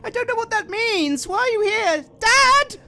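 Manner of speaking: put-on high small girl's voice crying for dad; a scared child calling out; frightened young girl. Girl in the bedroom cries out to her father, frightened.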